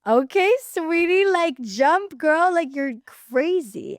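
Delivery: high, loud, drawn-out cheering; hyping girls. Retold or imitated. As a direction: imitate girls hyping up their friend. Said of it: "all the girls were like".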